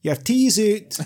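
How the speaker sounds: comic high-pitched motherly shout; mum calling out. Acted. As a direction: holler like a mum summoning her child for dinner. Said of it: "expect her next line to be".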